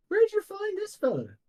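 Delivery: high-pitched questioning character voice; high, inquisitive in-character question; curious character. Acted. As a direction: pitch it well up, intrigued. Game master voices curious character.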